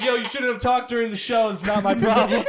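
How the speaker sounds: telling off the heckler while laughing; laughing retort. Retold or imitated. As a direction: toss it back gleefully. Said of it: "I was like".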